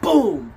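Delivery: loud, high mock hammer blow "Boom!". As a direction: slam out the sound of a nail going in. Imitating nails hammered in.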